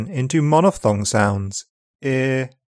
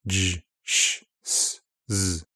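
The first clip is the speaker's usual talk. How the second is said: normal talking voice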